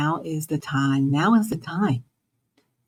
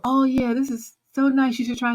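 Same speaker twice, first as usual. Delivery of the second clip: friendly urging; 7 semitones higher than usual. Retold or imitated. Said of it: "sees something for you".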